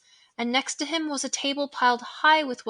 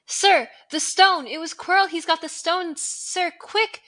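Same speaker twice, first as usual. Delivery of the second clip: high, loud, frantic pleading; boy urgently alerting the headmaster; urgent alarm; 6 semitones higher, 8 dB louder than usual. Acted. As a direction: panic to warn the headmaster. Harry wakes in alarm.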